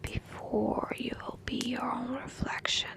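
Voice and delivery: ordinary delivery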